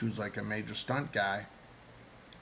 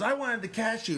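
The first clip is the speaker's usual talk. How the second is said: much higher, eager imitation of the director; eager admission; 11 semitones higher, 3 dB louder than usual. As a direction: imitate him blurting it out with enthusiasm. He goes, eager confession.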